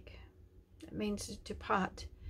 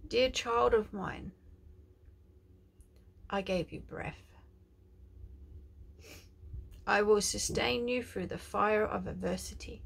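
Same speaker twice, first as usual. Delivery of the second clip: tender prophetic declaration; 3 semitones higher, 4 dB louder than usual. Acted. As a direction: speak as God comforting his child. Prophetic letter from God.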